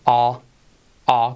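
"A" as an open, long aw sound; vocal A aislada. Shown as a demonstration. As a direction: hold the open vowel "A" on its own. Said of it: "con esa vocal A". ("A" abierta y alargada, aislada)

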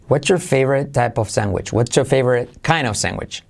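"What's your" run together as "whatcher"; linked what's your. Shown as a demonstration. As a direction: blend "What's your" into one CH-joined word. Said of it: "se convierte en what's your". ("What's your" unido como "whatcher")

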